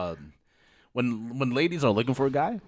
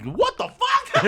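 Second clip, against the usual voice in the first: much higher, slow, laughing disbelief; shocked laugh; 4 dB louder, slower than usual. Retold or imitated. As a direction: drag it out in stunned laughter. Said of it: "I was like".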